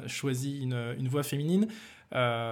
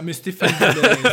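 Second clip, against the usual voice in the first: politician voice; 3 semitones higher, 9 dB louder, slower than usual. Pretend. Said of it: "comme les politiciens".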